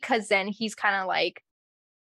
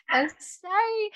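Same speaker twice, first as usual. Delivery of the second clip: giggly apology, pitched up; laughing; 11 semitones higher, slower than usual. Real, not acted. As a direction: laugh through the sorry.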